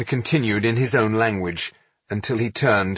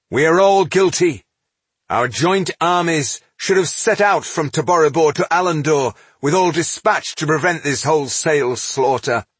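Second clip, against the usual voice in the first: raised, forceful admission of guilt; impassioned reproach; 7 semitones higher, 4 dB louder than usual. A narrator's reading. Leader tells assembly they share the guilt, impassioned. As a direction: drive the blame home. Leader blames all present.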